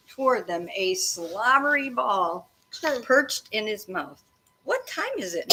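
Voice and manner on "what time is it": put-on raised pitch for a boy's question; a hint of a boy's voice; lightly voiced boy. A narrator's reading. Character question read aloud.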